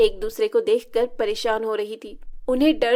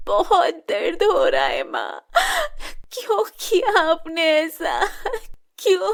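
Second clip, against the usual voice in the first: crying in pain; 7 semitones higher than usual. Acted. Shilpa crying to her stepmother from the burn, high and sobbing. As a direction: sob out the question.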